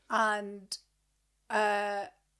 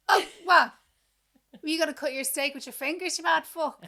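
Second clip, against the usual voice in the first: mock retort; 7 semitones higher, 8 dB louder than usual. Retorting at Shane over cutlery.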